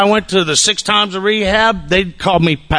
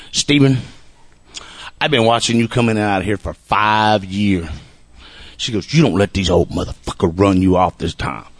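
lightly acted stern elder, pitched way down; old lady quote; 9 semitones lower than usual. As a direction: drop low and deliberate, imitating an old woman. Retold old lady addressing him.

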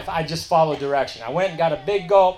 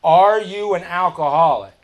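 loud, forceful retold question; confronting; 3 semitones higher, 7 dB louder than usual. Sponsor gets newcomer right where he needs him, confronting. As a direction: hit it hard as a point-blank challenge.

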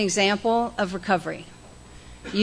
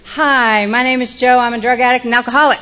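loud, booming introduction to the room; projecting hard into the mic; hollering; 4 semitones higher, 9 dB louder than usual. Real, not acted. Speaker too loud for the room, booming introduction. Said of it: "Am I hollering?".

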